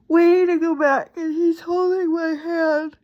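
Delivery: choked-up crying voice; real crying. Real, not acted. Recalling surgery, voice breaks crying.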